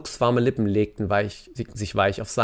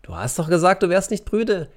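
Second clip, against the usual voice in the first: calling over music; 7 semitones higher than usual. A narrator's reading. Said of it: "rief über die Musik hinweg".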